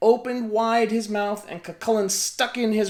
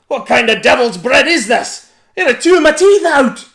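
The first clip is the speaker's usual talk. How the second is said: much louder, higher pained shout; giant shouting; 7 semitones higher, 14 dB louder than usual. A narrator's reading. Giant complaining about the bread, shouting. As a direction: shout in toothy outrage. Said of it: "shouted Cucullin".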